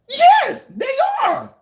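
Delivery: high, loud shout of agreement; emphatic shout. Real, not acted. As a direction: yell it out like a hard-won point. Firmly agreeing with critics' complaint.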